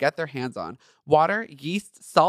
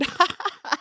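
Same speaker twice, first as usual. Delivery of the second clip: high breathy giggle. Real, not acted. Laughing at her own joke.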